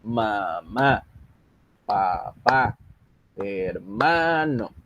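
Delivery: MAMÁ, PAPÁ, HERMANO with the stressed syllable drawn out: ma-MÁÁ, pa-PÁÁ, her-MAAA-no; exaggerated stress. Shown as a demonstration. Teacher showing where Spanish words carry their stress, exaggerated stress on Mamá, papá, hermano. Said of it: "así como yo las exagero cuando las digo".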